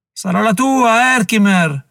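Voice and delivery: lievemente gracchiante, alto e lento; gracchiato. Acted. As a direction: una voce rauca e beffarda. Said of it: "gracchiò Harry Hugh". (Harry Hugh gracchia la minaccia al generale)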